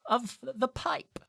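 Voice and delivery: high, slow, grand lecture voice; professor lecturing. Acted. Professor explaining the pipe rune to the student, high and slow. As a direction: lecture like a dotty old professor. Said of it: "she taps the board and she goes".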